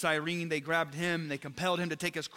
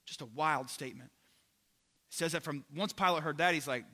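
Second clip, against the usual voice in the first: normal talking voice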